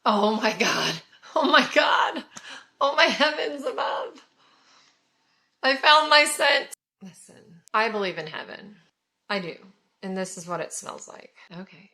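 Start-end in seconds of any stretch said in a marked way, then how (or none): 1.3-6.7 high, loud, gushing delight with a laugh; thrilled. Real, not acted. Smelling her new favourite perfume, high giddy delight. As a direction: squeal like you just found the one.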